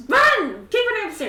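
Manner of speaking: high, loud command; urgent yell. Acted. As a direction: yell them up the stairs. Yelling at character to run.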